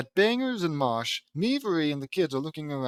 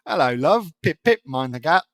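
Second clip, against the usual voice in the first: hammed-up British accent, louder; 6 dB louder than usual. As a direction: play a chirpy cliché British charmer. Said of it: "talked to me in that cute accent".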